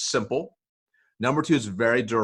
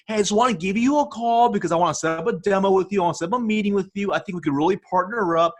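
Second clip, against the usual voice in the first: high, eager, overdone sales pitch; mock salesy voicemail; 8 semitones higher than usual. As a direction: ham up a pushy salesperson's voicemail. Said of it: "they are saying something like this".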